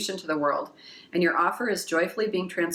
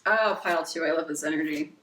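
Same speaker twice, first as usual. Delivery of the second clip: normal talking voice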